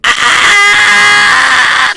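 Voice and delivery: long, high-pitched, very loud scream; sustained shrieking "ah" at the top of the voice; [name]'s scream. Acted. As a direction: scream in full panic, hammed-up. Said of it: "like, screaming".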